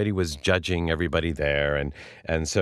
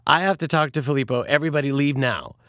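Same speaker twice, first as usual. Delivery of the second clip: retold insistent order, raised pitch; commanding; 7 semitones higher than usual. Woman sending guests away to talk privately, commanding. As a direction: act out a woman dismissing the room.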